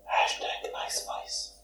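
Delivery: slow breathy whisper. Retold or imitated. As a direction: whisper it like a secret. Quoting a show bit.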